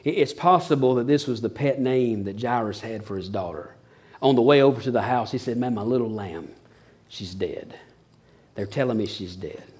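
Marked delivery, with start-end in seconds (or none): 5.6-9.6 soft, broken-hearted telling; a father holding back grief; quiet heartbroken voice; grieving father. Acted. Grieving father tells Jesus.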